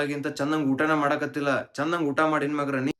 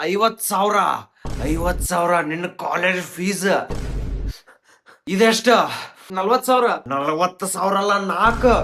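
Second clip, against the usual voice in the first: loud, raised-pitch parental telling-off; angry scolding; 2 semitones higher, 6 dB louder, slower than usual. Acted. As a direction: hammer it out like an angry mother. Mother scolds son over fees, failures. (ಸಿಟ್ಟಿನಿಂದ ಗದರುವುದು)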